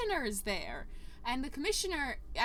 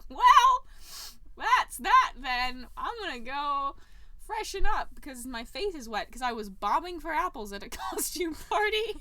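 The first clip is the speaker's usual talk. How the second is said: mock huffy exit; 5 semitones higher, 9 dB louder than usual. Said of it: "and they're like".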